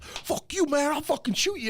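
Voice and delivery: high, strained mock threat, way up in pitch; imitating a gunman's squeaky threat. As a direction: spit it out like a panicked guy with a gun. Gunman threatening him at party.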